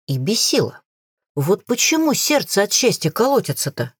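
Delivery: ordinary delivery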